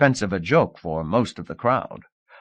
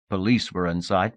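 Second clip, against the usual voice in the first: usual speaking voice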